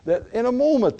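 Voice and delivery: high, loud, rising pulpit preaching; impassioned sermon climax; fervent preaching. Acted. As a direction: preach it at full force to the congregation.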